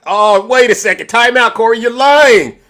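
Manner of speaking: loud high hammed-up protest; mock outrage. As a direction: pretend to argue back hotly. Mock argument with muted guest.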